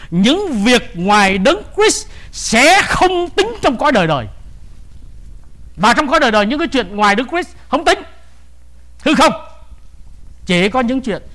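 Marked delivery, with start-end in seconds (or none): 0.1-9.3 forceful preaching. Acted. Preacher warning listeners about eternity, forceful.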